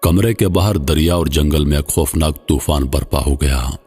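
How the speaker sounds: usual speaking voice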